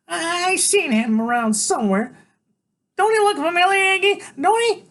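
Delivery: very high, loud character voice; squinting suspicious woman. A narrator's reading. Woman with cigarette tries to place a familiar face, high squawk. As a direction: nag Iggy in a high-pitched squawk.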